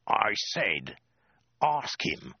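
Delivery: tense, spaced-out order; hissed threat. A narrator's reading. Soldier with sword at her throat, hissed order. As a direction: hiss it with mild menace. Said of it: "he hissed".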